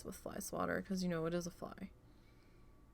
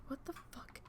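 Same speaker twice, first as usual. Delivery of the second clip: whispered; 6 semitones higher, 12 dB quieter than usual. Retold or imitated. Waking confused in dark.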